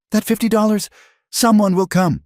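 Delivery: high, desperate pleading; frightened cry. A narrator's reading. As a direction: keep crying out in fear. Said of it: "she cried".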